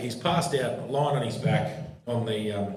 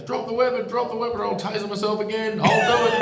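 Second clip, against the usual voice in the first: high-pitched mimicked police command; much higher, hammed-up warning; mock panicked threat; 11 semitones higher, 4 dB louder than usual. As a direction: imitate a cop yelping threats, building louder. Mimicking colleague's taser threat.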